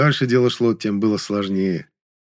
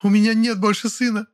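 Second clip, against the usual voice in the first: lightly voiced sobbing wail; 11 semitones higher than usual. A narrator's reading. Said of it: "Сквозь рыдание причитала она".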